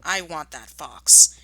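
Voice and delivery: loud, slow, seething demand; slow and loud, grinding each word; angry. Acted. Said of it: "he said angrily".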